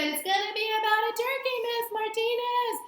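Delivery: lightly voiced eager pupil; child's answer. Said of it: "So if you said".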